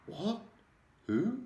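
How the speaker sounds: a father shocked on the phone; high startled squeak; voice goes funny. Acted. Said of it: "his voice goes all funny".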